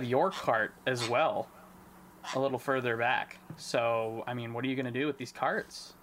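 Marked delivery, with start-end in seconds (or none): none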